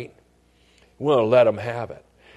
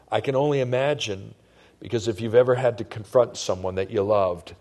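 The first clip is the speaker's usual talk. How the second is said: usual speaking voice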